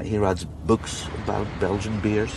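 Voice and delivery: usual speaking voice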